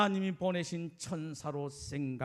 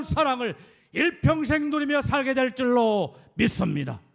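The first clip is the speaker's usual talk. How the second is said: fervent preaching; 5 semitones higher, 10 dB louder, faster than usual. Acted. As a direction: preach it out, rising. Preaching at full force.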